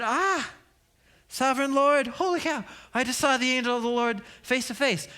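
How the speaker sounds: high startled exclamation to God; panicked Gideon. Acted. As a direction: gasp it out in awe and fright. Gideon terrified seeing angel.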